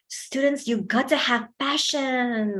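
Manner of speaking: slow, drawn-out words for emphasis; elongated emphasis. Shown as a demonstration. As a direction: stretch the words to stress the passion. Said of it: "that's elongating it".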